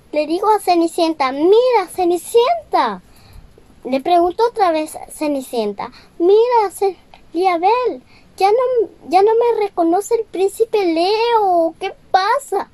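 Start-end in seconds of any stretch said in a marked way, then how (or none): 1.3-3.0 raised higher, a bit louder, kindly calling; higher fairy voice addressing Cinderella; fairy godmother voice. Acted. 6.2-12.7 higher pleading voice, a bit louder; a girl's high, urgent question; worried Cinderella. Acted. Cinderella asks the fairy worried.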